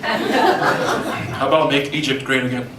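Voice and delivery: ordinary talk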